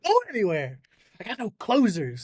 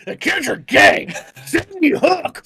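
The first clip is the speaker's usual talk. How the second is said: loud, high croaking rant; hammed-up glottal ranter; glottal fry; 4 semitones higher, 7 dB louder than usual. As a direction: force a strained fry through a shouted rant. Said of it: "I'm doing a Glotto Fry".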